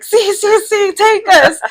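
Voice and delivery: high-pitched, excited retold plea; eager yes. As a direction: replay jumping at the offer.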